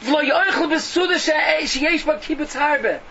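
slow, high, loud recitation; forceful declamation. Real, not acted. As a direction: hammer out the ruling like a preacher. Declaims Rambam's rulings at full force.

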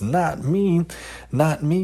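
high, pleading yell. A narrator's reading. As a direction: shout the denial again and again. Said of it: "yelled Zalman repeatedly".

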